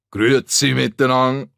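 loud, fast Swiss greeting called out; cheerful call. Acted. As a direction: call it across the room. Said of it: "ruft er". (Gruß laut und schnell gerufen)

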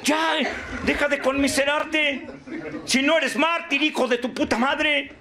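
high, forceful tirade from the podium; fiery scolding. Acted. Speaker berates the self-pitying martyr, fiery scolding.

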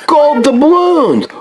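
high, slow treasure reveal; excited. Pretend. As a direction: savour it like pirate gold.

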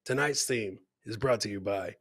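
usual speaking voice

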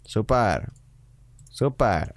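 deadpan, unenthusiastic super; sarcastic super. Acted. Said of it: "úplně to znělo nadšeně". (sarkasticky, bez nadšení)

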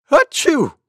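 big high sneeze; loud sneeze. Acted. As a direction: sneeze it out loudly. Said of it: "went Monkey".

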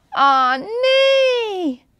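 loud, high wail of no; dismayed cry. Acted. Panda failing again, crying out loud and high. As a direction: despair as it all goes wrong. Panda's wiggle tail fails.

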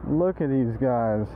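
ordinary delivery

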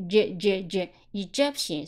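"Egyptian" with the weight on "gyp"; stress on second syllable. Shown as a demonstration. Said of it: "重音在第二个音节". (“Egyptian”重音落在第二个音节)

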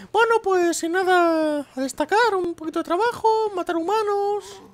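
very high, slow creature voice; squeaky slow monster voice; [name]'s voice. Pretend. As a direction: voice the monster answering casually. [name] answers in put-on voice.

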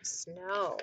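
ordinary delivery, nothing marked

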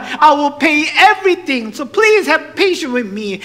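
high, forceful plea; loud pleading. Acted. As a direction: beg for patience at full volume. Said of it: "pleading with him".

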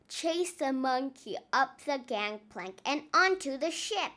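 ordinary delivery, nothing marked

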